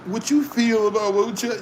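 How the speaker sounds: normal talking voice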